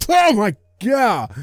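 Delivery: loud, high, drawn-out cry of shock; shocked outburst. Acted. Streamer hears a seven hundred dollar total, shocked shout. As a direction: wail it slowly, way up in pitch.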